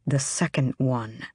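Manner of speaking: gritted teeth, low and tight; through gritted teeth. A narrator's reading. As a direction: speak through clenched teeth. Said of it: "he said through his teeth".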